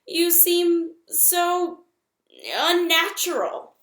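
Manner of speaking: high, loud, slow screech. A narrator's reading. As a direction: drag it out as a shrill screech. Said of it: "screeched the owl".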